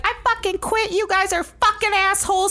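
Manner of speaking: high mock outburst; retold angry quitting. Counselor quitting on the staff, high mock outburst. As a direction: blow up and quit.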